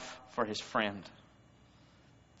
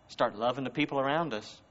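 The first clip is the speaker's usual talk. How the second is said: ordinary delivery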